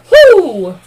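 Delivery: loud high exhale of shock; big stunned exhale; heavy whew. Real, not acted. Reacting to grim details.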